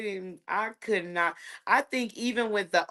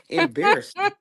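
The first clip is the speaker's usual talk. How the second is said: mock-outraged mom; 11 semitones higher, 3 dB louder than usual. Mom reacting to picture-day hair.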